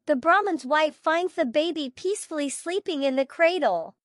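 plain reading voice

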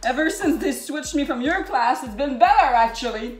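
angry shouting. Acted. Anger management joke continues.

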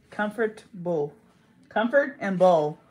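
comfortable slowly broken into comfort and bull; split comfort-bull. Shown as a demonstration. Puzzling over the word, comfortable split in two. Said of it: "It needs to be spelled".

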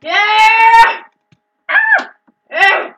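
loud high cheering; excited scream. Real, not acted. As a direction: scream with glee. Victory cheer.

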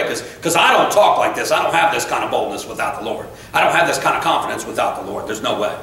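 ordinary talk, nothing marked

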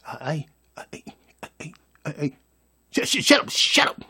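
scared stammer. Retold or imitated. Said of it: "they scared to tell a mother".

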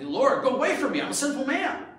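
high, loud mock pleading; hammed-up desperate plea; acted begging. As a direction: beg him to leave, voice pitched right up. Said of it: "he, he begged".

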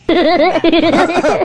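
high, loud burst of laughter; high-pitched cackling laugh. Acted. Laughter amid a comic skit.

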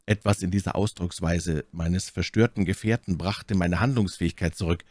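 ordinary delivery

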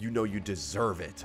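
very low, quiet guard voice; low, cold verdict; menacing prison guard. Acted. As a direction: sneer it deep and hushed.

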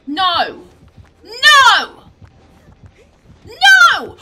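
loud "no" building to a high shriek; escalating scream. Real, not acted. Teammate in danger in game.

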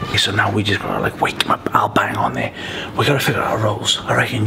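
hushed whisper. Real, not acted. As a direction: whisper so he won't hear. Sneaking around, plotting prank.

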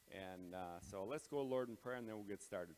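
ordinary talk

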